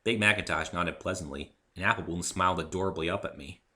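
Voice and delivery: plain reading voice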